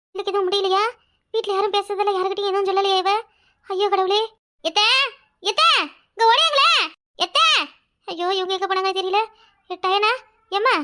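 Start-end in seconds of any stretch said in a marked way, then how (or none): 5.4-7.5 higher, urgent call across the house; anxious call out; calling for help. Acted. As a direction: shout for someone to come running. Calls for help from afar.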